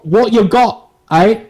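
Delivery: loud, high British challenge; Christopher Nolan, mock British. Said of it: "Here's my best Christopher Nolan".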